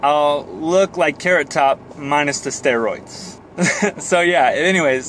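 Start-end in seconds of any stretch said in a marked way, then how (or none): none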